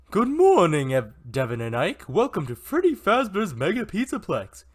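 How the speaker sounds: high, loud cartoon pig voice. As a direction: play a cheerful cartoon pig greeting guests. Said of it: "I'm gonna need a pig voice".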